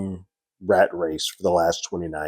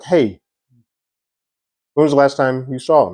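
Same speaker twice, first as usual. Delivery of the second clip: a mock detective leaning hard on him, voice raised; pressing hard; 6 semitones higher, 4 dB louder than usual. Detective grilling a suspect, mock hard-pressing question. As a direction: push the question like a cop who already knows the answer. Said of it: "coming on pretty hard".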